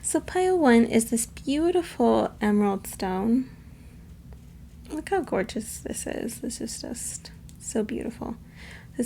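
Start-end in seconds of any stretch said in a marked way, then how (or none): 4.9-8.4 soft, breath-light aside; hushed admiration. Real, not acted. Reader admiring her beautiful emerald stone, hushed. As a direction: drop to a near whisper in admiration.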